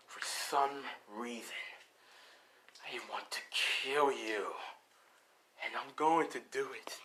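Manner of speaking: half-whispered threats from a villain relishing the kill; menacing villain. Acted. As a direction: play a sinister foe, breath taking over the voice.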